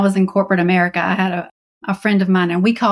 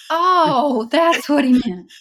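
higher, mock dawning "oh"; mock realization; 6 semitones higher, much slower than usual. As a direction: play a kid finally getting it. Imagined student realizing years later.

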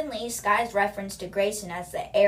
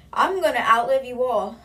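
put-on higher, louder replay of the proud old woman; defiant old lady; 4 semitones higher, 7 dB louder than usual. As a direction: repeat it with the same stubborn grandma swagger. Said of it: "she's a confident woman".

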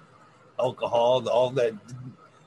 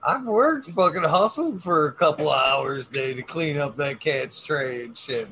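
ordinary delivery

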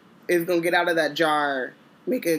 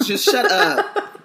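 laughing shut up; 7 semitones higher, slower than usual. Real, not acted. Mock exasperated praise.

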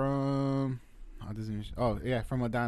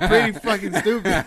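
laughing at himself; 7 semitones higher, 11 dB louder than usual. Real, not acted. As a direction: laugh it out, louder. Admits mistake laughing.

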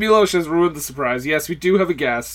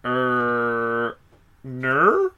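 Ermahgerd voice; 6 semitones lower, 5 dB quieter than usual. Pretend. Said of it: "It's the Irma Gerd Burks girl".

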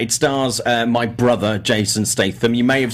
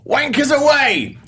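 big shouted catchphrase; slower than usual. Retold or imitated. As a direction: belt it out like a movie star's catchphrase. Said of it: "pointed, and went".

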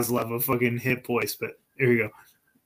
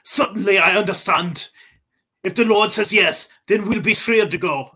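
higher-pitched mock Germanic accent; hammed-up Kozlov accent; botched Russian accent; 5 semitones higher than usual. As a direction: answer politely in a put-on accent. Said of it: "Kozlov needs the Russian accent".

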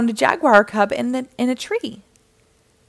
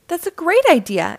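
high, louder delighted exclamation; excited exclamation; 7 semitones higher, 5 dB louder than usual. Acted. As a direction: sound thrilled by the idea. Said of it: "exclaimed Mister Grouper".

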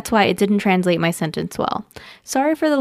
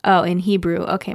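ordinary delivery

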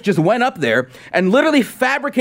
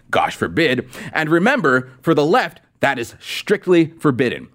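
ordinary talk, nothing marked

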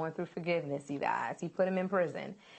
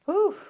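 sharp, high exhaled whew of amazement; loud high whew; 13 semitones higher, 8 dB louder than usual. Real, not acted. Preacher reacting to the brothers' guilt with a loud whew.

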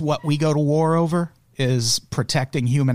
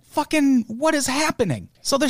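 exasperated disbelief; 12 semitones higher than usual. Real, not acted. Exasperated outburst at doublespeak.

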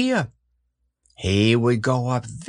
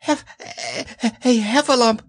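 high, panting stammer; breathless squeaky panic; Piglet out of breath; 11 semitones higher than usual. A narrator's reading. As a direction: gasp it out in broken pieces. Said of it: "breathing so hard that he could hardly speak".

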